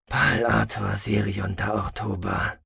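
slow, resonant voice used to giving orders; satisfied, sonorous commander. A narrator's reading. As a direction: savour it with calm authority, slowly. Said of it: "zufrieden mit klangvoller, befehlsgewohnter Stimme". (zufrieden, klangvoll und befehlsgewohnt)

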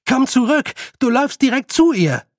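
high, loud warning shout; alarmed calling. Acted. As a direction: call her back urgently. Boy calls after fleeing girl. (alarmiert hinterherrufen)

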